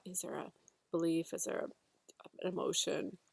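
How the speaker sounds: usual speaking voice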